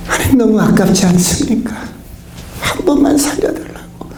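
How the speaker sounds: crying prayer. Retold or imitated. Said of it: "울면서 기도해요".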